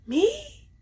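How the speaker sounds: stunned at the accusation; squeaky disbelieving "me?"; incredulous. Retold or imitated. Accused of spreading gossip.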